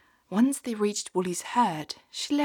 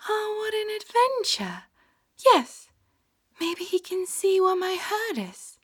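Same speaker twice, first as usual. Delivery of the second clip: excited; 13 semitones higher, 5 dB louder than usual. Acted. Sheep delighted.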